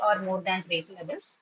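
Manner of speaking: ordinary talk, nothing marked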